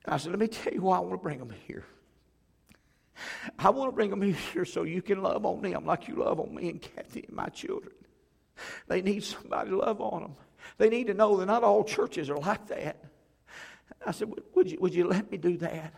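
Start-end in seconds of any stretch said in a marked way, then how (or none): 0.3-13.0 pitched high, pleading from the heart; impassioned plea. Retold or imitated. Pastor begging his church to welcome a hurting family, impassioned and high. 14.3-15.9 pleading question. Retold or imitated. Pastor asking church's permission.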